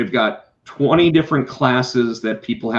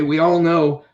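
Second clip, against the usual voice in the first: ordinary delivery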